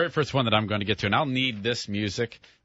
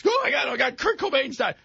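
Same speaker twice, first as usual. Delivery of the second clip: frantic boss; 12 semitones higher than usual. Retold or imitated. Panicked program director bursting in.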